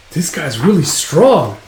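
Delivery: drawn-out acted amazement; awed alarm. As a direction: marvel uneasily at the opponent. Men amazed at Guts' strength.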